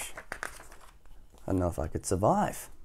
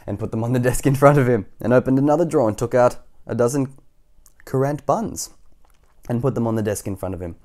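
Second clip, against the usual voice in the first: brisk, animated storybook listing; story narration; 4 semitones higher, 11 dB louder than usual. A narrator's reading. Reader listing Doctor Plump's food, animated narration. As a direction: pile up the treats with relish.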